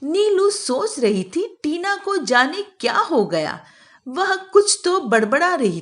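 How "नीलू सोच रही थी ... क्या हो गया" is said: usual speaking voice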